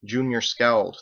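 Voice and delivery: usual speaking voice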